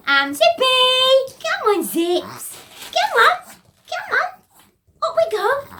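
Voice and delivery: high, loud, slow coaxing; pet voice. Real, not acted. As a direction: lure the little one up. Coaxing Zippy up.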